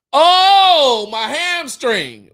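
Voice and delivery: high, slow mock hamstring groan; fake injury. Player faking a hamstring injury, hammed-up high groan. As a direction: ham up a fake injury, drawn out. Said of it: "I'm being like".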